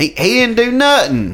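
high, slow put-on voice; mock defender. Mock defending Cosby to his co-host, high and slow. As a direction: plead his innocence in a silly voice.

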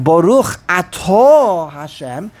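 slow, "Atah" stretched a-TAAH into "Hashem"; connected, no stop. Shown as a demonstration. Teacher comparing the blessing, end-stressed "Atah". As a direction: carry the long end of "Atah" onward. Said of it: "then you don't stop".

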